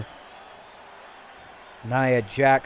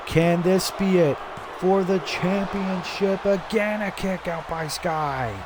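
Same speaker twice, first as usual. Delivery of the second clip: rising excitement; 5 semitones higher than usual. Real, not acted. Near-fall during a power bomb.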